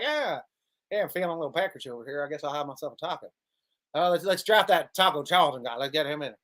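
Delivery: lightly voiced Jerry, rushing lazily through it; lazy Jerry voice. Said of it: "that's how, the way he sounds".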